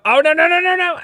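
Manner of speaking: high, loud alarmed cry. Real, not acted. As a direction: stop the cat, alarmed. Cat escapes on camera.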